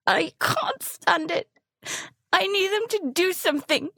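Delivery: words cracking with tears; tearful outburst. Acted. Kid begging frozen parents to act, tearful.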